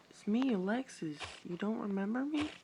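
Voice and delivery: put-on high-pitched girl voice, hurt and pleading; Alexis character voice. Alexis pleading with CJ to remember her, high voice. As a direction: remind him who you are, gently.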